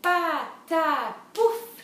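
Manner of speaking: "patapouf" very slow in three loud beats; "patapouf" split high and loud; syllable-by-syllable. Shown as a demonstration. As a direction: clap out "patapouf" as pa, ta, pouf. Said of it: "On va les compter".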